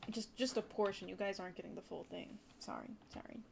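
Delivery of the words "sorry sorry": breathy whispered apology; quick hushed sorry. Real, not acted.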